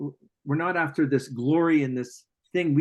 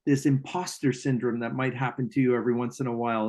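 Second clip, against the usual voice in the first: ordinary talk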